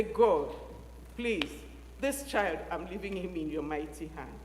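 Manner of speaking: put-on a mother entrusting her child to God, hushed prayer. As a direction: let the child go in a low murmur. Mother's whispered prayer of release.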